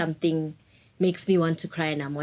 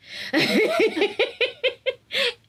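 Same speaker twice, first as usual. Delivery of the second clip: high laugh. Real, not acted. Laughing at her own ex-boyfriend story. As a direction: burst of high embarrassed laughter.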